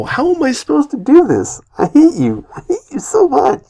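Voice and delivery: high-pitched exasperated wail. Real, not acted. Reader defeated by the tongue twister, exasperated wail. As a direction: squeal in helpless frustration.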